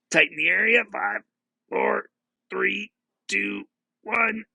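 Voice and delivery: strained, high countdown on a held breath; holding breath. Real, not acted. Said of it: "Nice deep breath in".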